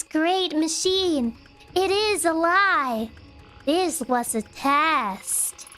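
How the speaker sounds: creepy calm cultist. Acted. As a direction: calmly coax her to let go. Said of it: "cultist-based nightmares".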